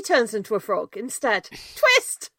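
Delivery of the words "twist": excited exclamation. Acted. Announcing the plot twist.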